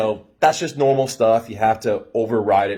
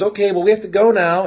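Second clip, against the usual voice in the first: high, louder put-on voice of the curt friend; mock friend brush-off; 10 semitones higher, 6 dB louder than usual. The friend pulling her away from him, a high loud mock brush-off. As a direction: bark it out high like the eager-to-leave friend.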